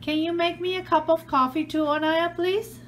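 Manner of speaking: normal talking voice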